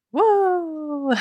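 high whooping cheer; excited whoop. Pretend. Cheer about tourism money.

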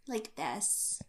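breathy baby-voiced Valley Girl. Shown as a demonstration. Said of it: "Cross between a baby voice and Valley Girl voice".

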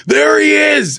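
hammed-up gleeful reveal; mock excited pointing. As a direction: call it out like spotting a celebrity. Voicing the woman spotting the guy.

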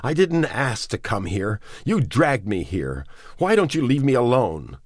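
woman begging the man to let her go; desperate outcry. A narrator's reading. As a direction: fling it at him in despair. Woman pleading to be released.

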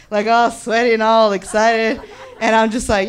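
ordinary talk, nothing marked

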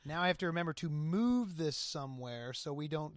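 ordinary talk, nothing marked

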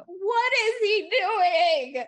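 loud, very slow, high-pitched wail; exasperated wail. Real, not acted. Fan baffled by William's blabbing, wailing in exasperation. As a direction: drag out every word, loudly aghast.

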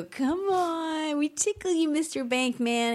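high, coaxing tickle voice; mock cajoling, slowing at the end; playful teasing. Acts out tickling a banker.

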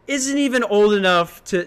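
usual speaking voice

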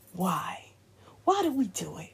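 hushed whispered questions. Real, not acted. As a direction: drop to a whisper to draw viewers in. Rhetorical questions to viewers.

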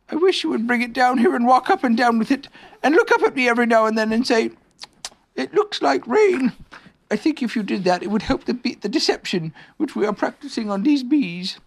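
high-pitched bear giving instructions; Pooh bear, scheming. Acted.

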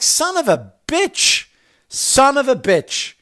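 loud, high, drawn-out curse; exasperated cursing. Retold or imitated. Dad reacting to his son's faked limp, exasperated cursing. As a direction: stretch it out in high-pitched disbelief. Dad fed up with faking son.

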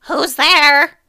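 high, loud call of who's there. Acted. Grandmother calling to the knock at the door.

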